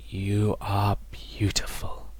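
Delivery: slow breathy whisper. Acted. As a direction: whisper it slowly, fading. Dying father's last words.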